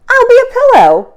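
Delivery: high, bright little-creature voice; excited high voice of the small porcupine; eager little porcupine. Acted. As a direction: chirp it like an eager young porcupine. Fluffy tries again.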